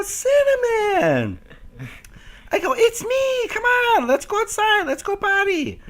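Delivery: very high, slow, drawn-out coaxing; high pleading coax; pleading pet talk. Retold or imitated. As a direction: beg the dog to come out.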